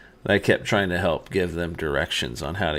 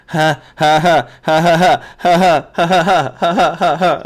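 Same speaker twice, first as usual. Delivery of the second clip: loud, high staccato ha ha ha, imitating a maniacal laugh; 11 semitones higher, 13 dB louder than usual. Husband reenacting his drugged laugh for his wife, maniacal cackle. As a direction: cackle like a madman, hammed up. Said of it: "My wife said I was laughing like this".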